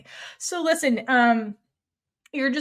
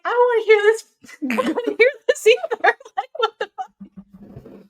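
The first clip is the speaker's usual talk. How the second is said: laughing disbelief; 11 semitones higher, 4 dB louder than usual. Retold or imitated. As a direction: squeal it through laughter, then drag out the last line. Mon hearing Sam's hateful confession.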